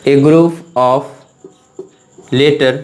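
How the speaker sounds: each word spaced out slowly for copying; slow dictation. Shown as a demonstration. Teacher dictating a definition.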